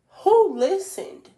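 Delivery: high, slow astonished cry; stunned disbelief. Real, not acted. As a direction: ask it in sheer disbelief. Excited disbelief at listens.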